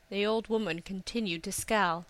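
usual speaking voice

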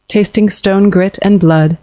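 plain reading voice

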